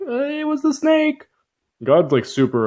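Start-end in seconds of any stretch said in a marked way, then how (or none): 0.1-1.3 very high squeaky Eve; high, hammed-up girly voice; mock Eve. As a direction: blame the snake in a tiny high voice. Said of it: "Eve's all like".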